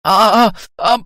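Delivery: high yelps of mock ear-pulling pain. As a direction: yelp as your ear gets twisted. Mother pulling his ear.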